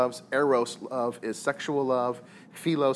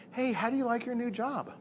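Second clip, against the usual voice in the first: bright friendly question; 10 semitones higher, 3 dB quieter than usual. Retold or imitated. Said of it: "she's like".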